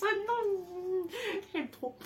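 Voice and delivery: high whimpering coo; cute whimper. Real, not acted. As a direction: whine with delight. Adoring the egg character.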